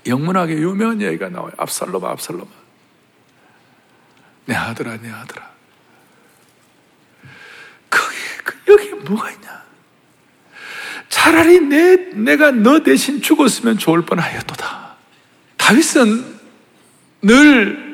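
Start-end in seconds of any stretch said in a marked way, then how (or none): 1.6-5.5 soft lament. Acted. Quoted lament, soft and low. 11.1-15.0 a father wishing he had died instead; tearful cry. Acted. David's lament, voice breaking.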